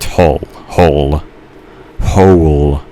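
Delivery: "hole" tested slowly with a thick, closing L; struggling to pronounce. Real, not acted. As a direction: say "hole" over and over, drawing out the last one. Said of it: "Why can't I pronounce it?".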